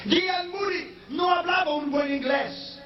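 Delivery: high ringing shout; preacher's shout. Acted. Preacher telling the crowd about Moody, ringing shout. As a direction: cry it out to the back pews.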